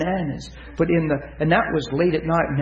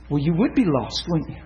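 ordinary delivery

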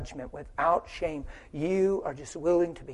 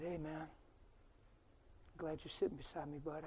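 quiet retelling; 23 dB quieter than usual. Retold or imitated. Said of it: "And I just said".

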